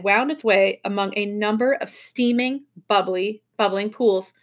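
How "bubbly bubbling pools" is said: ordinary delivery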